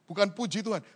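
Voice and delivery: normal talking voice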